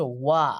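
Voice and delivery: ওয়া held long as an open "waa"; sound demo. Shown as a demonstration. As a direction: stretch out ওয়া to show the w sound. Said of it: "উচ্চারণ হচ্ছে". (ওয়া লম্বা করে টেনে উচ্চারণ)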